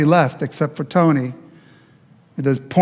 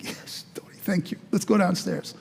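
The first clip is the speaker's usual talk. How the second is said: softer, near whisper of relief; quick quiet thanks, much softer; hushed; 3 semitones higher, 9 dB quieter than usual. Retold or imitated. Hushed relief after Tony agrees.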